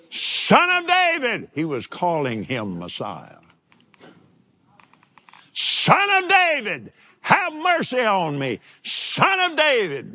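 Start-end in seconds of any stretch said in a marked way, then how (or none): 0.1-1.5 high, slow, drawn-out cry for help. Retold or imitated. Blind beggar crying out to Jesus, high and drawn out. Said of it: "you remember what he cried". 5.5-10.1 crying out. Retold or imitated. Blind Bartimaeus begging Jesus, high pleading cry. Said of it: "you remember what he cried".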